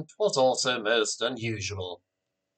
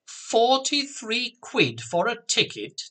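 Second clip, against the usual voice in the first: high, slow, incredulous question; astonished at the price, pitch shooting up; amazed; 10 semitones higher than usual. A narrator's reading. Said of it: "Jack said, amazed".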